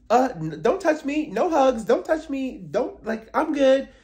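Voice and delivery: high, loud mock rebuff; mock Gizelle rebuff. Mocking Gizelle refusing Wendy's hug, loud and high. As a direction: shriek it like a diva refusing hugs.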